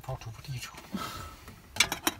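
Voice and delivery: slow breathy hot, hot, hot; too hot. Real, not acted. Husband eats a scalding chip, blowing out hot hot hot. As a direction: puff the words out past a burning mouth.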